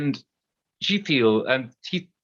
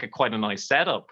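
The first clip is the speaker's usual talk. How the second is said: ordinary talk, nothing marked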